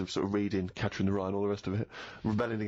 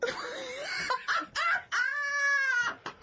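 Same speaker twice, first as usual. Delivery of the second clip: shrieking laugh, much higher. Real, not acted. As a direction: howl with laughter. Laughing at Martin Wells story.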